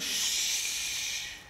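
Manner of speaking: soft unvoiced shush; gentle shush. Jesus gently hushes the weeping widow, whispered. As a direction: hush her tenderly. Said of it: "Siguro ang sabi ni Jesus".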